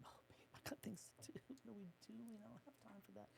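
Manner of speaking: hushed, breathy aside to his wife; whisper. Retold or imitated. Husband whispering to wife, urgent hushed whisper. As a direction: whisper it so the consultant can't hear.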